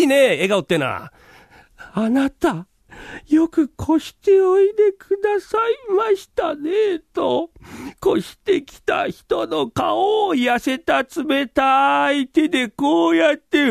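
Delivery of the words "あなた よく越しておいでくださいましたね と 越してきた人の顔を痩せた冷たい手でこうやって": high, slow, wailing ghost of the murdered wife. Acted. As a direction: moan it out like a weeping ghost. 幽霊の台詞を演じる. (殺されたおかみさんの幽霊が越してきた人を迎える、高く泣くような声)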